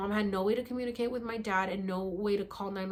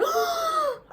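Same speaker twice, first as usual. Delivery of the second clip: high, loud gasp for air; waking from sleep, gasping for breath; desperate gasp for air; 7 dB louder than usual. Retold or imitated. Reenacting choking in sleep.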